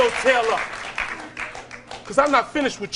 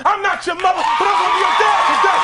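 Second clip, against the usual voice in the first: furious scolding; 4 semitones higher than usual. Real, not acted. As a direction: lay down the law, full force. Scolding defiant teen on show.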